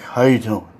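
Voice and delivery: imitating a winded, wheezy big man; low voice with audible puffing; heavy breathing. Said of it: "he's Darth Vader breathing without the mask".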